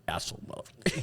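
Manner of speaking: voiceless, soft swearing aside; whispered cursing. Retold or imitated. Golfer curses the man from the course, whispered. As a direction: whisper the insult under your breath.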